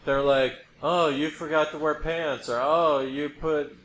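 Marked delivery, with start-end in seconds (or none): none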